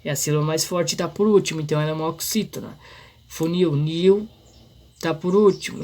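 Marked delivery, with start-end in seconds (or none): 3.3-4.3 "Funil" slowly, leaning on NIL, then "nil" alone; stress on NIL. Shown as a demonstration. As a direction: stretch the final "nil" of "Funil" and repeat it. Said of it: "ela é a sílaba mais forte". ("Funil" devagar, com ênfase em NIL)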